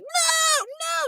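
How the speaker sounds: very high squealed mock scream; imitated child's scream. Imitating a child refusing the vaccine, high mock scream. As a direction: shriek like a kid, without real volume. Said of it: "screaming as loud as he can".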